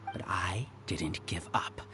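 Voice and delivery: low, fast whispered scorn; whispered contempt, quick and low; cold whisper. Acted. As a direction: hiss the words at your rival. Villain coldly addressing rival.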